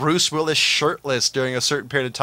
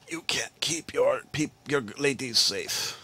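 put-on Russian accent; 8 dB quieter, slower than usual. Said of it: "her accent was something else".

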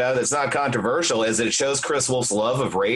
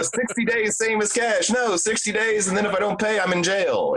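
ad pitchman voice; 9 semitones higher than usual. Retold or imitated. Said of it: "Or like".

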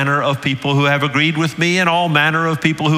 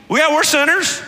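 mock agreement; 14 semitones higher than usual. Voicing the culture agreeing.

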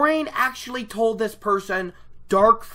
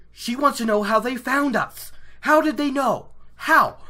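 rising alarm; 7 semitones higher, 5 dB louder than usual. Real, not acted. Building excited retelling.

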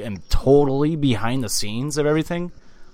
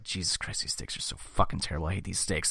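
whispered, softer disgusted grumble; MJF muttering aside; 5 semitones lower, 11 dB quieter than usual. Retold or imitated. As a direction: hiss your disgust under your breath. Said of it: "M J F's like".

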